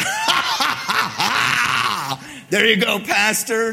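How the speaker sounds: mocking high cackle, then a loud jab; hammed-up fake laughter at the pastor; loud sarcastic ha-ha from a congregant. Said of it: "A little bit of sarcasm there".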